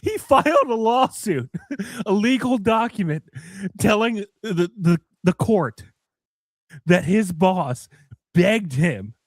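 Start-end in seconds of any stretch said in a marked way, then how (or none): none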